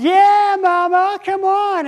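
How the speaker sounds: high, loud taunting call; mocking heckle. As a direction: jeer at her from the back. Gang member heckling, high.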